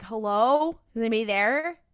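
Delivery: mock tentative shout for company; calling out. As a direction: call into the dark for anyone. Trapped woman calls out in retelling.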